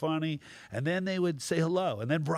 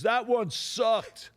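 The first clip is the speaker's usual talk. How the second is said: slow drawn-out heckle, pitched higher; a slow, high mock heckle from the crowd; heckler's jeer; 8 semitones higher, much slower than usual. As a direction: drag out the heckle slowly. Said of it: "some guy goes".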